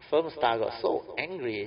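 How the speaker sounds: usual speaking voice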